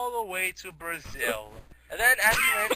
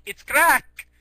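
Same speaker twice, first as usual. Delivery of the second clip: put-on Mexican accent; 7 semitones higher, 8 dB louder than usual. Mexican guy revealing what he sells, loud Mexican accent. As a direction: announce it proudly in a mock Mexican accent. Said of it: "can you stop with the accent?".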